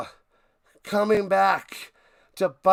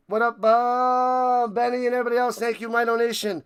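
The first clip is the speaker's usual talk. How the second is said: ordinary talk, nothing marked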